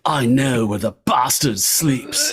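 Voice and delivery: slow, hammed-up fake British accent; mock bad English accent. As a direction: quote it in a terrible put-on English accent. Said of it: "I quote that constantly".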